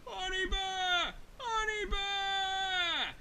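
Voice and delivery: high, drawn-out call; acted shouting. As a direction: call out to a friend far off, stretching the words. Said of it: "he started shouting".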